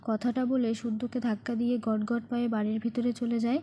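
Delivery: ordinary delivery, nothing marked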